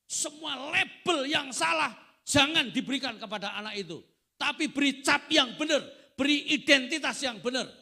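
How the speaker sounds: high, shouted exhortation; impassioned preacher at peak volume; full-force preaching. Real, not acted. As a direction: cry the command out to the whole congregation.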